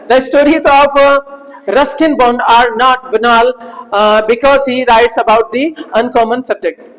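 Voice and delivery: slow, word by word reading of the example; slow dictation. Shown as a demonstration. Teacher reads example sentence slowly to class. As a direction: read each word out slowly for students. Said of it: "slow dictation of example".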